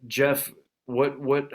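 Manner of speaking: usual speaking voice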